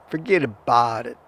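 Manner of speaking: lightly voiced New York mobster accent; a hint of a tough-guy New York gangster; New York gangster impression. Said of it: "that's my gangster impression".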